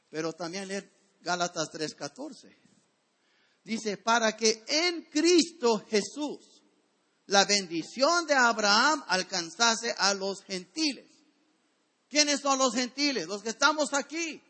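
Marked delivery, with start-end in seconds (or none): 4.0-11.1 scripture reading. Acted. Reads scripture aloud.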